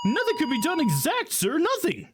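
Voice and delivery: high, eager rookie officer; high excitable cop voice. Acted. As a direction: play him as a jumpy, overeager young cop.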